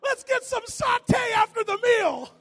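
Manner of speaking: loud, slow, high call across the room; shout. Real, not acted. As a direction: call it out over the crowd. Shouted aside before sermon.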